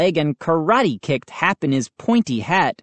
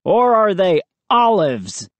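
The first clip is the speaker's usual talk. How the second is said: mocking comeback, slightly raised voice; taunting retort; 4 semitones higher, 3 dB louder than usual. A narrator's reading. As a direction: needle the rival gardener. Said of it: "Moose's dad shot back".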